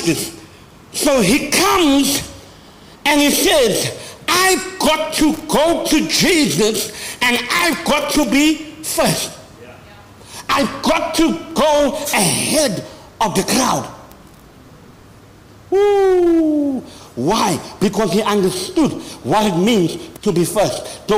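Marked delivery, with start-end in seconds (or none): 0.9-13.9 fiery preaching. Real, not acted. Preacher voicing Zacchaeus to his congregation, fired up. 15.7-16.8 high loud woo; excited whoop. Real, not acted. Preacher whooping to the congregation, high and loud.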